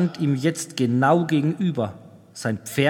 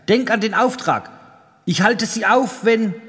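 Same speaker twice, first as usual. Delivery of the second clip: urgent, higher-pitched warning that breaks off; abrupt breaking off; 6 semitones higher, 4 dB louder than usual. A narrator's reading. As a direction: press it urgently, then stop mid-sentence. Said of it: "er brach ab". (drängend, mitten im Satz abgebrochen)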